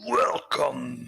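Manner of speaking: drawn-out character greeting; Resident Evil Merchant. Acted. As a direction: put on a video game shopkeeper voice. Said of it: "the merchant from Resident Evil".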